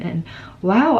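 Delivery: ordinary talk, nothing marked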